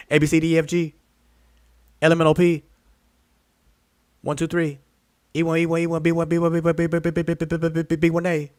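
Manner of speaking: high rapid auctioneer babble. Retold or imitated. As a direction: rattle it off like an auctioneer. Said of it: "car auction, auctioneers".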